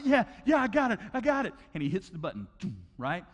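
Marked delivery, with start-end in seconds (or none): 0.0-1.5 high, loud, excited cheering; excited kid. Retold or imitated. Child at the claw machine, excited shouting. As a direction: crow it like a kid about to win. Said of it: "And he's excited".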